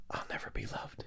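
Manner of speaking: breathy whisper of a lonely line; whispered quote. Retold or imitated. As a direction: whisper it as if overheard. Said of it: "I heard him say".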